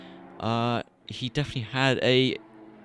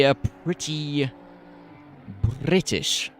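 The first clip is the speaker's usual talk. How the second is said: mock posh British "a pretty British", the t in "pretty" and "British" dropped; 3 semitones higher, slower than usual. Shown as a demonstration. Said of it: "That's how you talk it".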